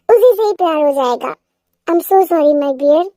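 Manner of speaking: ordinary delivery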